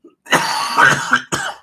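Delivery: loud hacking chest cough; a rough, rasping coughing fit; real coughing fit. Real, not acted. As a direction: hack and splutter into the mic. Chest infection, coughing on stream.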